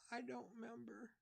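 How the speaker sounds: put-on very high, soft child's voice; a small sad boy; lost boy telling the cop he forgets; little boy.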